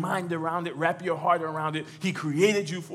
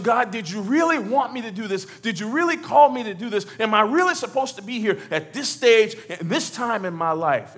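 higher, louder questioning of God; pleading questioning; 5 semitones higher, 6 dB louder than usual. Acted. Tested believer asking God about the calling, pleading questioning. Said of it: "we begin to question".

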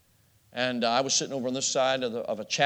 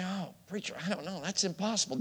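high, soft, doubtful protest; a hint of a whiny skeptic, higher and softer; doubting listener; 6 semitones higher, 8 dB quieter than usual. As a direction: play the doubter who thinks it can't be done. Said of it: "You, you say".

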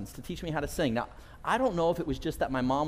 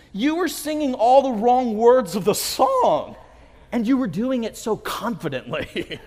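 friend laughing; 8 semitones higher, 7 dB louder than usual. Retold or imitated.

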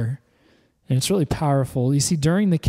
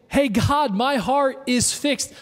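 model prayer to repeat; 7 semitones higher, slower than usual. Shown as a demonstration.

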